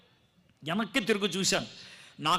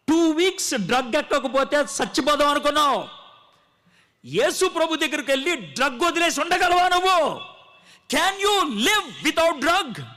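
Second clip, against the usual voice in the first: louder, higher, stretched challenge; preaching at full force; 6 semitones higher, 5 dB louder than usual. Acted. Preacher challenging his listeners about drugs, loud and high. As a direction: hurl the question at the congregation.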